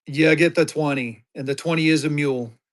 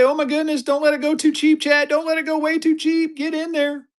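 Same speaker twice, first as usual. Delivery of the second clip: much higher, excited squeaky pitch; voice jumps up an octave; 12 semitones higher than usual. Real, not acted. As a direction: let your voice shoot way up in excitement. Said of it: "why my voice went up another octave".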